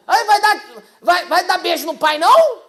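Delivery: high, loud whining plea; dad whining for a goodbye kiss from daughter; playful dad to daughter. Retold or imitated. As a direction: coax a kiss like a needy dad. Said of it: "eu já falo".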